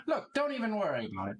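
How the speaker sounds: high, coaxing barmaid voice; a barmaid calling her husband over. Acted. Barmaid calls to Lot.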